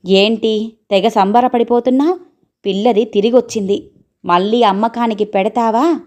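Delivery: ordinary delivery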